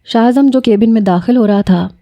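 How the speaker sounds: usual speaking voice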